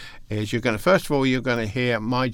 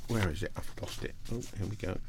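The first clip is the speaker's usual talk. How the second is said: barely voiced mutter to himself; unvoiced under-the-breath grumble; whispered aside; 2 semitones lower, 11 dB quieter, faster than usual. Real, not acted. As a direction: drop to a breathy whisper while hunting for notes. Searching notes, muttering to himself.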